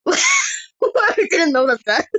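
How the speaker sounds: loud, high-pitched real laughter through the words; laughing hard. Real, not acted. Player catches a silly slip, real helpless laughter. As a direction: crack up and barely get it out.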